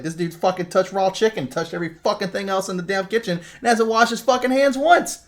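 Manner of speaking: mock disgusted rant. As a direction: rant in disgust at the filthy cook. Imitating Taffer's disgusted rant.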